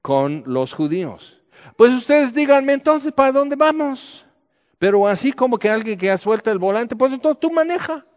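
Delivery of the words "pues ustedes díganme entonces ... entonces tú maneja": higher, louder mock sarcasm; sarcastic God. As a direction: hand over the wheel with biting irony. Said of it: "es Dios muy sarcástico".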